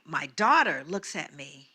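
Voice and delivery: ordinary talk, nothing marked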